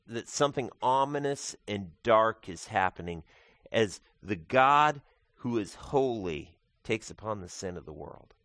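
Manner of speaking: ordinary talk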